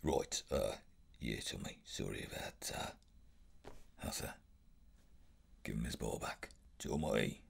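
sheepish, embarrassed. Shown as a demonstration. Child Arthur caught by Tommy, sheepish mumble. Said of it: "goes all sheepish and embarrassed".

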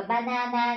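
banana with every "a" said fully; wrong Vietnamese-style pronunciation. Shown as a demonstration. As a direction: say banana as three equal Vietnamese syllables. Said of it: "không đọc là banana nhá". (banana đọc sai kiểu Việt, âm "a" đều)

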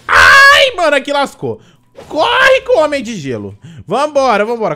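panicked shouting while fleeing; loud frantic yelling to run; being chased. Real, not acted. Boss attacks, he flees.

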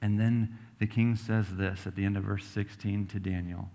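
usual speaking voice

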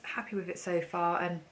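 usual speaking voice

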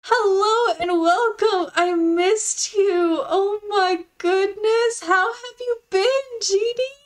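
high, drawn-out, adoring welcome; gushing delight. Real, not acted. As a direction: coo slowly at someone you really missed. Gushing over returning viewer.

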